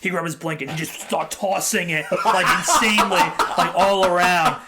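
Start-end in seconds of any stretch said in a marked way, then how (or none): 2.2-4.6 laughing loudly through the words; amused loud laughter. Real, not acted. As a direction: laugh it out, louder. Laughing while telling dog story.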